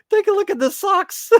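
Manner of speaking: very high squeal breaking into laughter; high, helpless amusement; cracking up. Real, not acted. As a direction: squeal it, about to lose it. Cracking up at ridiculous socks.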